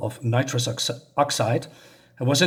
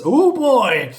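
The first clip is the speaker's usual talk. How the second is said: put-on loud, high "oh boy"; hammed-up high exclamation; acted dismay; 10 semitones higher, 6 dB louder than usual. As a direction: exclaim it like a robot caught out. Robot reacts, must backpedal.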